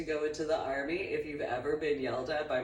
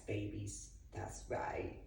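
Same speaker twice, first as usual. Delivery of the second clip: breathy romantic whisper; teasing soft whisper; 7 semitones lower, 12 dB quieter, slower than usual. Shown as a demonstration. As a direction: lean in and whisper it. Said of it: "That's my romance".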